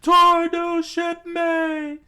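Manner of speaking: high, slow, very loud yell; screamed toast. A narrator's reading. Usopp toasting the new shipmate, screaming. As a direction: bellow the toast, stretching it out. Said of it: "Usopp screamed out".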